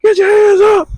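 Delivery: high-pitched mock heckle at the TV; mock screaming. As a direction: imitate an armchair fan yelling up high. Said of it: "they screaming at the T V".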